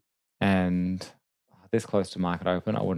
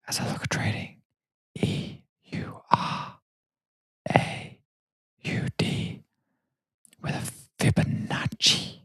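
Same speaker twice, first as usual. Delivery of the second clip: slow, soft whisper right into the microphone; mock ASMR trading whisper; creepy ASMR whisper; 5 dB quieter than usual. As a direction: whisper each letter and word slowly. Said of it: "That's weird and creepy".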